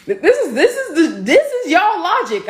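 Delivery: high, emphatic voice shooting upward; heated retort. Real, not acted. Answering someone gatekeeping womanhood, high-pitched heated retort. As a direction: push the words up high in protest.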